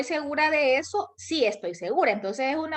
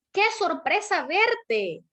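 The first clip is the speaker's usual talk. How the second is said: slow, higher, emotional surprise; surprised exclamation; 5 semitones higher, slower than usual. Shown as a demonstration. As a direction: say it with real feeling, as a true surprise. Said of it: "La entonación es importante".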